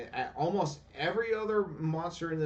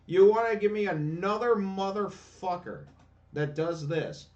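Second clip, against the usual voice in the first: louder, higher furious outburst at the game; real gamer rage; 5 semitones higher, 6 dB louder than usual. Real, not acted. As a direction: yell it at the screen, truly fed up. Raging at game's unfair fight.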